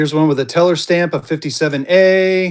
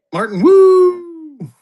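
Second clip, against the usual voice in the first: loud, high, long-held woo; excited whoop; 13 semitones higher, 7 dB louder, much slower than usual. Real, not acted. Seller cheering Martin's purchase, excited whoop. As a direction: whoop the buyer's name in celebration. Cheering a buyer who joined.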